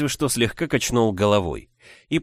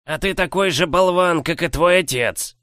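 growling; 8 semitones higher, 3 dB louder than usual. Acted. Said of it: "прорычал он".

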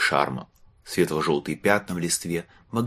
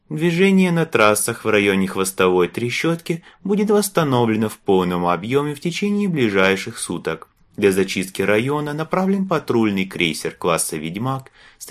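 loud, formal public announcement; spaceport announcement; 5 semitones higher, 5 dB louder than usual. Acted. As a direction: read it like a station announcer. (объявление в космопорту, громко и официально)

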